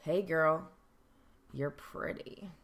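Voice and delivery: sleazy whispered come-on; creepy catcall. Shown as a demonstration. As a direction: drag it out like a creep.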